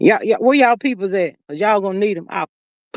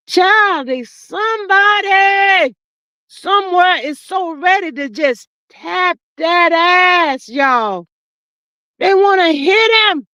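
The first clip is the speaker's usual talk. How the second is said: worked-up rant; 7 semitones higher, slower than usual. Acted. Host ranting to viewers about holding back, rising to a shout. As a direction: whip yourself up until you're nearly yelling.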